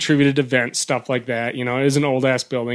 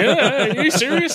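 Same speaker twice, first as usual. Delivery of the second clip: mock agitated buddy, pitched up high; worked up; 9 semitones higher than usual. Spooked buddy reacting to the moving curtain, worked up. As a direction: imitate a rattled friend, half amused. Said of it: "He's all worked up".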